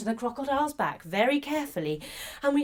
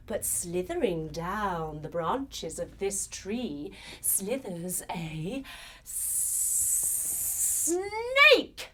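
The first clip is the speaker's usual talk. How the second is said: suspenseful reveal; 4 semitones lower, 4 dB quieter, much slower than usual. A narrator's reading. Storyteller reveals a snake in the tree, suspenseful. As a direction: creep slowly up to the reveal.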